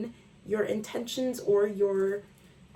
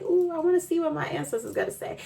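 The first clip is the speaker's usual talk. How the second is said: high, fast, excited quote; eager viewer; 9 semitones higher, faster than usual. Retold or imitated. Viewer eager to hear from ancestors, high and fast. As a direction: gush like a curious viewer. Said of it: "you're like".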